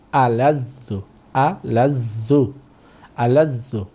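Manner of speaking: আলাযযু with a held doubled z, slowed on the third take; teacher models আলাযযু slowly for students to repeat; model pronunciation. Shown as a demonstration. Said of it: "আমি উচ্চারণ করছি, আমার উচ্চারণ থেকে শুনে নিন".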